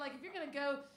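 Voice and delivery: ordinary delivery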